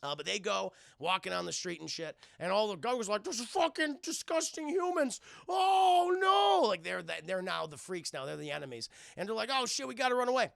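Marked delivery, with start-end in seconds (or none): 3.2-5.2 high put-on voice of revulsion; disgusted crowd member. Gargoyle crowd spotting humans on the street, high disgusted voice. 5.5-6.7 high, loud drawn-out wail; mock horror. Gargoyle crowd horrified by humans, high loud mock wail. 9.5-10.5 mock alarm. Freaks realise they must flee.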